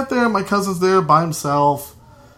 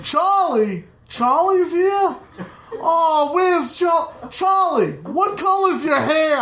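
high, laughing mock excitement; hammed-up delighted relative; excited drunk uncle; 10 semitones higher, slower than usual. Uncle thrilled to find his nephew, high and laughing. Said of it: "I was like".

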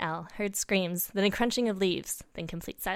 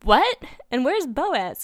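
high, loud shocked questions; startled, raised acted voice; exclaimed; 7 semitones higher, 8 dB louder, much slower than usual. A narrator's reading. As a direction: burst out in disbelief. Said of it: "exclaimed Mofo".

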